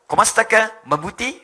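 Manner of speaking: loud imitation Filipino greeting; lightly mimicked Filipino accent. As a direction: ask it like a Tagalog speaker. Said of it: "kayak orang Filipin".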